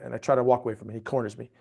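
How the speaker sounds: ordinary delivery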